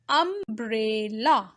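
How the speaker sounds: umbrella slowly split as um, bre, lla; syllable by syllable. Shown as a demonstration. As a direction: sound out umbrella in three beats. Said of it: "It's having three syllables".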